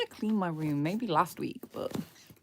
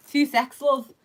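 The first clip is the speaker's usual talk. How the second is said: put-on Scouse accent; 8 semitones higher, 6 dB louder, slower than usual. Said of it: "Scouse accent".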